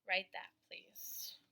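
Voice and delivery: unvoiced whisper. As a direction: whisper the instruction. Whispered instruction.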